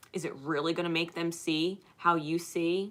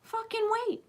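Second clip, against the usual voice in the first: emphatic high plea; 14 semitones higher than usual. Acted. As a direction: stretch out fucking, pleading high. Urging listeners to hold back.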